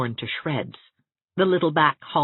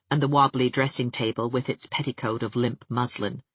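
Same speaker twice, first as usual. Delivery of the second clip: ordinary delivery, nothing marked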